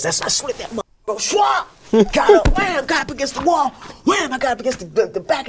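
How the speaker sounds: high, loud, fired-up yelling of the blows; fight shout. Retold or imitated. Telling a friend about the van fight, shouting every blow. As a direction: shout each hit like it's landing now.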